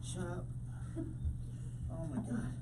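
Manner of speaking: soft, low hungover grumble; hungover mumble. Acted. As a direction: grumble it through a hangover.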